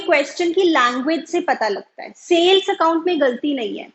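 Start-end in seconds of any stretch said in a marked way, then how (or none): none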